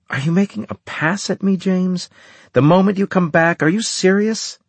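high, incredulous, rising questions; shocked by his nerve; indignant disbelief. Acted. Anne indignant at pass.

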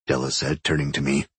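ordinary delivery

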